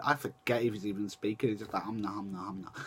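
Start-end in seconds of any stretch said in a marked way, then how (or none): 1.8-2.8 low, very quiet indistinct mumble; Channing Tatum. Retold or imitated. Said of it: "when Channing Tatum talks in his normal accent".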